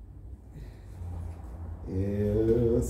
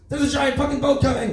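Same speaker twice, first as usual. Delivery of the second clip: high, loud alarm yell; sudden yelled warning; panicked shout; 6 dB louder than usual. Acted. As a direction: scream the warning at the crowd. Anthem turns into warning shout.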